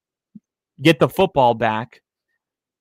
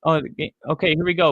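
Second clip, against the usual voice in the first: usual speaking voice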